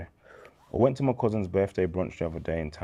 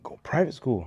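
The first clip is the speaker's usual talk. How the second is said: imitating surprise; 8 semitones higher than usual. Friend's shocked question.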